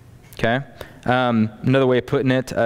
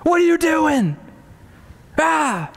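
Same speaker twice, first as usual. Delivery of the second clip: put-on screaming at child; 12 semitones higher, slower than usual. Parent screaming at a child who broke something, hammed-up yell. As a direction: yell at the kid over the broken thing. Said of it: "you scream at the child".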